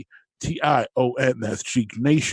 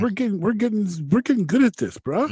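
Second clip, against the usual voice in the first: excited; 8 semitones higher than usual. Real, not acted. Excited high retort.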